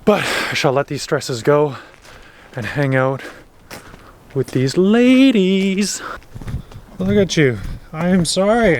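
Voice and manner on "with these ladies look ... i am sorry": higher, drawn-out coo to the horses; sing-song apology to animals; greeting her horses in a fond high coo; sweet pet talk. Real, not acted.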